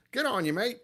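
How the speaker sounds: put-on a jokey, self-aware Australian accent; bad Australian accent. Streamer thanks an Australian customer in an admittedly bad Australian accent. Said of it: "My Australian accent's insulting".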